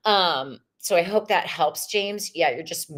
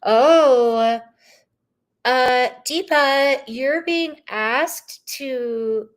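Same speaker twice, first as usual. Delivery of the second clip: louder, higher, slow reveal; emphatic reveal; 6 semitones higher, 6 dB louder, much slower than usual. Real, not acted. Reader revealing Deepa's card, loud and slow. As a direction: draw out the card's message.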